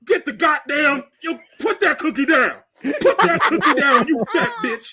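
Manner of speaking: loud, high mock yelling; hammed-up furious scolding; comedian's scolding rant. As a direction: shout it like a roast, laughter creeping in. Imitates comedian scolding TV participant.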